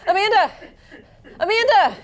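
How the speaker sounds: ordinary talk, nothing marked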